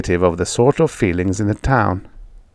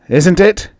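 "Isn't it?" repeated much higher, pressing and louder; insistent repeat; 10 semitones higher, 5 dB louder than usual. A narrator's reading. Drunk farmer pressing his friend, louder insistent repeat. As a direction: demand an answer this time. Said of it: "He raised his voice".

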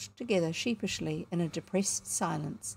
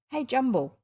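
higher-pitched call to a dog; 6 semitones higher, 4 dB louder than usual. A narrator's reading. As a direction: call the dog, raised but not shouted. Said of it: "he called".

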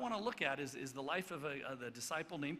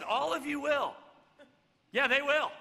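louder and higher in disbelief; incredulous raised repeat; exasperated; 10 semitones higher, 12 dB louder than usual. Real, not acted. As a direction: hit it in disbelief. Incredulous retort about Jesus' words.

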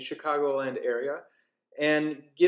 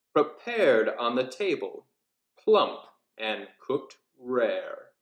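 rhythmic storybook reading with character voices; lilting Halloween storyteller; story narration; 4 semitones higher, 4 dB louder than usual. A narrator's reading. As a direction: read the rhyme like a spooky bedtime tale. Reads nursery rhyme aloud.

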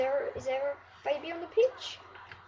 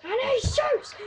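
mock commentator call; 6 semitones higher than usual. Mock call, then frustration.

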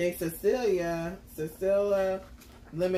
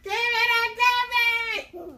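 put-on whiny baby voice; 6 dB louder than usual. Playful tug-of-war over gummy.

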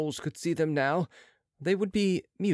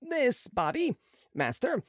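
higher, brisk surgeon's voice; a proud young surgeon, pitched up; Marat's voice; 11 semitones higher than usual. A narrator's reading.

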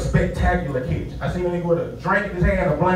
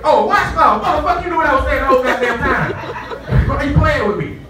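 higher, louder, fast mock outrage; mock angry manager; 8 semitones higher, 3 dB louder than usual. Hotel manager scolding the guest, higher mock anger. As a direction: call him out for playing games. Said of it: "He said".

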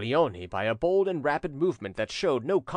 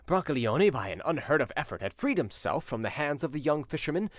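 ordinary delivery

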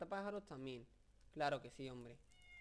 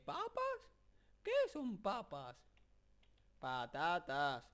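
Gollum; 3 semitones higher, 4 dB louder, much slower than usual. Retold or imitated. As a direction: squeak the questions, then spit out the answer slowly. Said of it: "la frase esa de". (imitación de Gollum, aguda y lenta)

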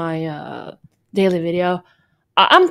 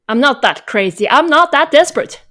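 indignant protest; 5 semitones higher, 7 dB louder than usual. Acted. Denying she's desperate for fans.

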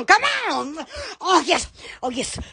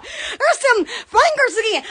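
usual speaking voice

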